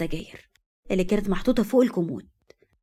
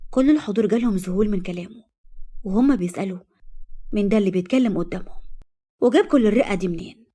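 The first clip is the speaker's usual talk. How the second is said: plain reading voice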